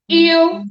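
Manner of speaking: il said high and loud; stressed il. Shown as a demonstration. Teacher spelling aloud for students, "il" punched. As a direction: call out "il" clearly. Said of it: "And you write".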